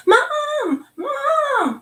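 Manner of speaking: put-on high, sing-song child's call for Mom. Mother imitates her daughter calling her, high child voice. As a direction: call out like a little kid wanting mom.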